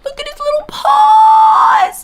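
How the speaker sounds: very high, loud, slow squeal; cute overload squeal. Real, not acted. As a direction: squeal like you just saw a puppy.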